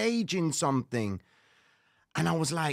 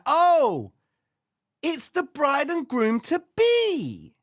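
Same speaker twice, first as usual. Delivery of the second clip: higher and louder, slowed announcement; mock surprise; 9 semitones higher, 5 dB louder, slower than usual. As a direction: act out the shock as it lands. Retold surprised exclamation.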